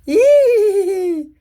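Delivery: long, high, loud squeal. Retold or imitated. Character takes a turn scaring the wizard, high squeal. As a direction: let out a drawn "eee" on one high note. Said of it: "She goes".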